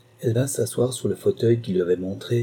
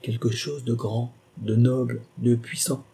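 each quality set apart by pauses; measured, word-by-word emphasis; detached words. Acted. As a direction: separate grand, noble and puissant deliberately. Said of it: "elle détacha ces mots".

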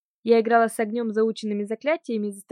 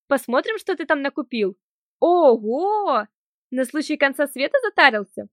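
loud cheerful; 4 semitones higher, 6 dB louder than usual. Acted. Cheerful act continues.

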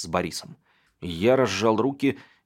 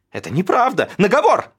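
high, loud sobbing protest; accused brother sobbing his denial; sob; 13 semitones higher, 9 dB louder than usual. A narrator's reading. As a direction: cry it out, near tears. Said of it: "всхлипнул Александр".

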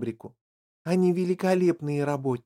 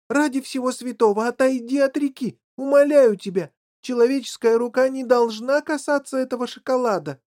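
high, urgent imploring cry; loud shout; 7 semitones higher, 5 dB louder than usual. A narrator's reading. Factory owner protecting chocolate from human hands, urgent shouting. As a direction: implore at raised pitch. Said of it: "громко закричал он".

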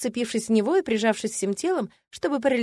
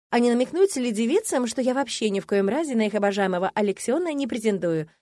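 ordinary delivery

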